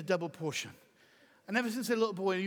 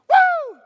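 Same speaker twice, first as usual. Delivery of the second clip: excited whoop; 17 dB louder than usual. Real, not acted. Shout of excitement.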